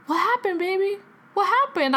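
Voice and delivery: high-pitched imitation of a worried dad; imitated fatherly concern. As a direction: ask it high, coaxing a daughter. Dad's concerned question retold.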